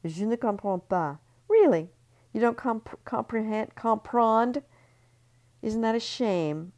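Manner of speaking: high, loud sneering fake French; mock her for not understanding; mocking taunt. Ellie mocking Marie Claire's English, loud and sneering.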